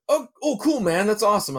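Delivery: high-pitched, easily impressed buddy; imitated enthusiasm. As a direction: act out a friend's delighted reaction, pitch jumping up. Acted friend reacting to main event.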